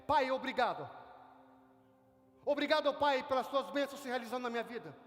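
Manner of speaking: loud, fervent thanks to God; bold thankful prayer. Shown as a demonstration. Pastor models a prayer of thanks for the congregation, loud and fervent. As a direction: proclaim thanks with full conviction. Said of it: "a sua segunda oração deve ser".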